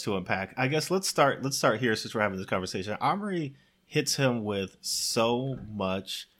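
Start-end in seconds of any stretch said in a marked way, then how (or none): none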